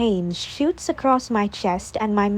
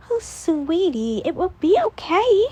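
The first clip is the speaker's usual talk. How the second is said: tender comfort; 7 semitones higher than usual. A narrator's reading. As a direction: soothe her gently. Jera comforts sister.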